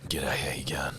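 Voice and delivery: put-on low, hushed Australian accent; mock Australian accent. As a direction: put on a quiet Aussie greeting. Mock Australian greeting after accent talk.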